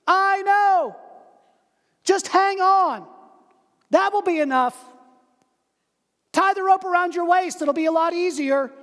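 high, loud calm instructions; calling up. Acted. Dangling stranger calls up instructions. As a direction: call up steady instructions.

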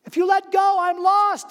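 high, loud pleading call. Acted. Dangling stranger calls up a plea. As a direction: shout up the plea.

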